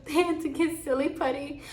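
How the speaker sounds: amused laughter; laughing. Real, not acted. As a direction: laugh through the words. Laughing at the memory.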